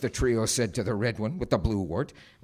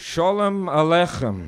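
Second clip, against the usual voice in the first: "Shalom Aleichem" slower, higher and louder; "Shalom Aleichem" drawn out louder for the slow-witted; insistent repeat; 7 semitones higher, 7 dB louder, slower than usual. Acted. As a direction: spell out "Shalom Aleichem" emphatically. Repeats his name, misunderstood.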